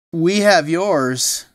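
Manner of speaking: daddy reply. Acted. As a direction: answer slowly with relief. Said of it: "replied Trixie's daddy".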